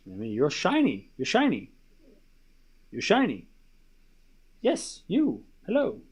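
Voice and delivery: soft cooing to a dog; tender pet talk. Real, not acted. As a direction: dote softly on your pet.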